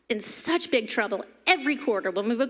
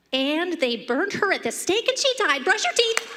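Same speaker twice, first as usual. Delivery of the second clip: abrupt bedtime mom; 8 semitones higher than usual. Retold or imitated. Mom ending a story at bedtime, high and brisk. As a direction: rush from tragedy to bedtime orders. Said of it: "she's like".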